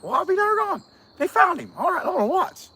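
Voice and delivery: high, mock-excited fan voice; mock excited viewer. Vlogger mimicking an eager viewer, high mock-excited voice. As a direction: play the eager viewer who thinks they found him.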